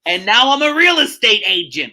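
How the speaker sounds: big ironic ta-da delivery; mock triumph. Real, not acted. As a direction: belt out the punchline with false pride. Sarcastic punchline about her career.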